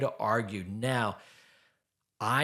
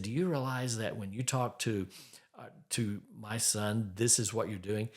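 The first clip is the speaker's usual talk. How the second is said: softer, hushed, gentle question; wife lovingly pointing out his stern voice, softer; 3 semitones lower, 7 dB quieter than usual. Retold or imitated. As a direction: ask it tenderly, without blame.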